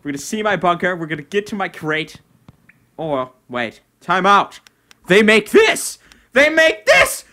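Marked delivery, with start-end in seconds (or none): 5.1-7.2 high, loud shrieks of joy; real thrilled yelling; ecstatic shout. Real, not acted. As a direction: scream it out in pure delight. Finds teleport, overjoyed.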